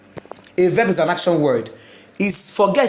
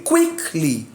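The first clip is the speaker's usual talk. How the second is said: "Quickly" as QUICK-ly, up then falling; first-syllable stress; 3 semitones lower than usual. Shown as a demonstration. Teacher repeating the drill, QUICK-ly in "Quickly". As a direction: shape "Quickly" like a triangle, peak on QUICK. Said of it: "Like a triangle".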